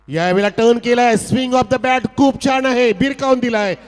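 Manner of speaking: high, loud boundary call; excited commentary. Real, not acted. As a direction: shout the boundary with joy.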